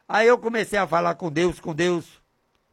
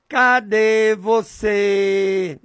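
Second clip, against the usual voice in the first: long drawn-out, raised call; calling out to God; 3 semitones higher, 3 dB louder, much slower than usual. Shown as a demonstration. Preacher shows how he calls to God, drawn-out calling. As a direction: stretch each word out as a call to someone unseen. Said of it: "Eu digo desse jeito".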